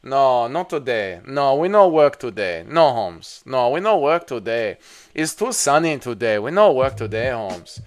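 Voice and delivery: sing-song, hammed-up Mexican accent; mock Mexican accent. Streamer practising for chat as a work-shy Mexican, mock Mexican accent. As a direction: put on a bad Mexican accent, stretching the vowels. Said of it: "I need to work on my Mexican".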